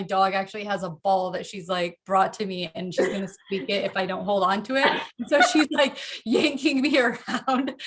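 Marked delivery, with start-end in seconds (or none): 5.2-7.9 laughing while the dog tugs; giggling through the words; laughing as the dog yanks her around. Real, not acted.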